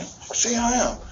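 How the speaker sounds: high, strained, tearful yell; crying dare. Retold or imitated. Son crying as he dares his father, high tearful yell. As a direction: push the dare through tears.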